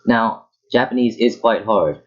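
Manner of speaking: normal talking voice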